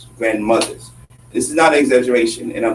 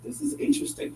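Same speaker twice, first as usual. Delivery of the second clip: wry murmur; 14 semitones higher, 11 dB quieter than usual. Retold or imitated. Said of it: "I'm just like".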